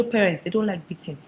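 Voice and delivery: ordinary talk, nothing marked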